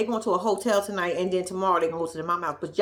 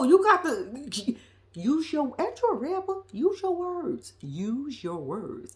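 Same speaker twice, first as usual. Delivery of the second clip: slow coaching scold; 5 semitones higher, much slower than usual. Acted. Telling Michael to explain to her mama, slow scolding. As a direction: spell it out slowly, as to a child.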